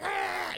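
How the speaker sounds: high-pitched mock electrocution cry; trembling cry. Mother getting tased by her son, trembling cry. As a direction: cry out as if being zapped. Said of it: "She's, like, trembling".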